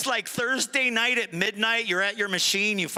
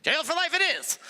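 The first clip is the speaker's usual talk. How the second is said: nonchalant; 8 semitones higher than usual. Retold or imitated. Shrugging acceptance before laughing.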